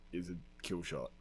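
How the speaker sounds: ordinary talk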